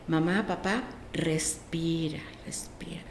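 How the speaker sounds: slowing down into a hushed whisper; soft calming coaching. Retold or imitated. Teacher showing kids how to calm parents, hushed and slow.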